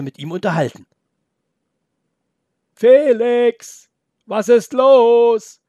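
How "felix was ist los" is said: much higher, louder call, drawn out slowly; calling from afar. Acted. Grandpa calls to the boy from behind, loud and long. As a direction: grandpa shouting across the clearing. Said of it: "hörte der Opa von hinten rufen".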